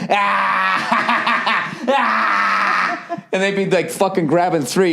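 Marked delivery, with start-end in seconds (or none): 0.1-1.8 high, taunting clown shout-laugh; mocking clown cackle. Clown heckler taunting a missed throw, high mock cackle. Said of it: "he'd be like". 1.9-3.2 high, taunting clown shout-laugh; mocking clown cackle. Clown heckler taunting a missed throw, high mock cackle. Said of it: "he'd be like".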